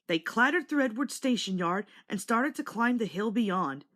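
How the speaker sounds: plain reading voice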